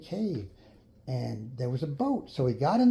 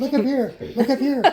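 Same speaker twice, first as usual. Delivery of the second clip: high, loud calling voice; creatures calling; 10 semitones higher, 7 dB louder than usual. Acted. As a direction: call down from above, high and loud.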